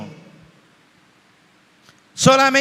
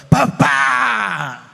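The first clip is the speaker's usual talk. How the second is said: put-on a child shouting for daddy; high child's shout for daddy; child's cry; 4 semitones higher than usual. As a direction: call out like a lost child. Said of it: "esa manera de gritar de los chicos".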